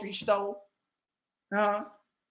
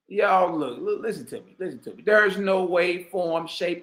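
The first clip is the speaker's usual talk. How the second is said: ordinary delivery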